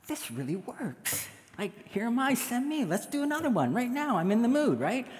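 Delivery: high, sing-song eagerness; volunteer brightly in a high lilting voice; eager volunteering. Acted. Eager believer asking God for another assignment, high and excited.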